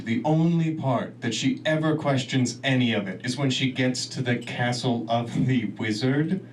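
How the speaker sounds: ordinary talk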